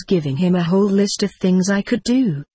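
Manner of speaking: ordinary delivery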